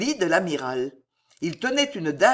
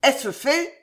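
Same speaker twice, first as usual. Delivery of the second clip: high, raised questioning call; demanding shout upward; 7 semitones higher, 3 dB louder than usual. A narrator's reading. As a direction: call it up from the courtyard. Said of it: "appela encore Henri de Guise".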